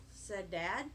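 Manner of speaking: ordinary delivery, nothing marked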